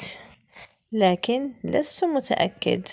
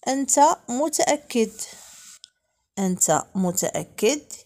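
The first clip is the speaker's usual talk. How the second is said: usual speaking voice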